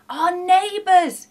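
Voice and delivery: loud, high, drawn-out shout; whooping yell. A narrator's reading. Sam tells Shifty whom to rob, whooping yell. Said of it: "yelled Sam with a whoop and a shout".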